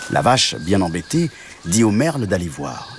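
ordinary delivery

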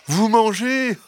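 high, incredulous fox voice; amused fox. Acted. Fox laughing off the hens' fear, amused. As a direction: toss it back high, about to laugh. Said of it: "répondit le renard".

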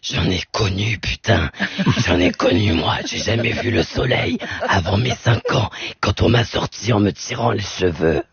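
put-on very low, rough old diva voice; Jeanne Moreau voice. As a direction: growl it like a worn old star. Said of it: "la voix de Jeanne Moreau".

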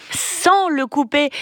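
SANS drawn out and pitched high; "sans" stretched and lifted for emphasis; stress on SANS. Real, not acted. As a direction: lean hard and slowly on "sans". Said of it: "Ça, c'est important".

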